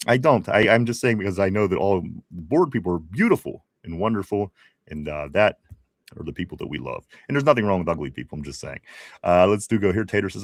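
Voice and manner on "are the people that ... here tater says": normal talking voice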